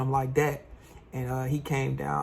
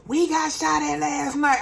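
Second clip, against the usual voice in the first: much higher, slow blurted confession; tattling little brother; 13 semitones higher, 4 dB louder than usual. Retold or imitated. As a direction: spill it like a kid telling on you.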